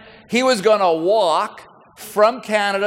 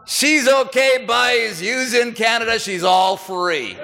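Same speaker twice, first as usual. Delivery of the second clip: put-on slow, easy Newfoundland accent; slower than usual. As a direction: drawl it out like a Newfoundlander from the Rock. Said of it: "says in the finest accent from the Rock".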